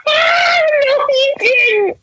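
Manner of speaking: very high, squeaky laughing denial; helpless laughter. Real, not acted. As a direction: barely get the words out for laughing.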